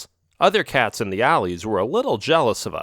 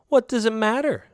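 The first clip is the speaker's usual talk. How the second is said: higher, teasing dodge; coy girlfriend cat; 11 semitones higher than usual. Acted. Girlfriend cat brushing off his question, coy and higher.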